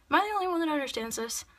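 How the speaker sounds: exasperated. Real, not acted. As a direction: throw up your hands in disbelief. Exasperated rant.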